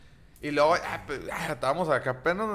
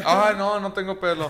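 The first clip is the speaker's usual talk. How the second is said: usual speaking voice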